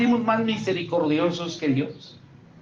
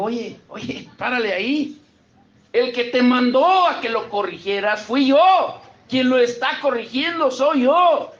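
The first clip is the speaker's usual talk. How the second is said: high, loud divine rebuke; raised preacher voicing God's reproof; God rebuking; 9 semitones higher, 4 dB louder than usual. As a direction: scold the prophet from above. God rebuking Samuel, paraphrased.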